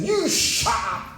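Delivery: high, drawn-out mock yell; mock angry shout. Stranger turns on the diner in the corner, mock angry shout. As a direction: burst out high, imitating an aggressive stranger. Stranger snaps at him, retold.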